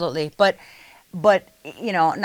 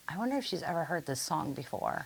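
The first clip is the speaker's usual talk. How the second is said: quietly wondering to herself, much softer; hushed inner thought; 13 dB quieter than usual. Retold or imitated. Watching her friend solo, murmuring the thought softly. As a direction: drop to a near-private murmur. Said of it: "and I'm like".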